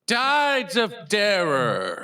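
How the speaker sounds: dramatic announcer. Acted.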